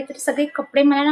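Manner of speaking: ordinary talk, nothing marked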